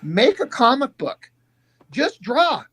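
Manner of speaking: high, slowed, emphatic retold order; exasperated urging. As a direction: spell it out to a stalling buddy.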